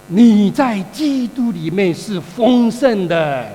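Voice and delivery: preacher slowly declaring abundance in Christ; slow emphatic. Shown as a demonstration. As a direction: proclaim it slowly for the congregation to repeat. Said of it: "我再说一次，你跟隔壁讲说".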